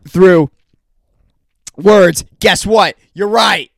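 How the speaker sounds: angry. Real, not acted. Venting anger at imagined listener.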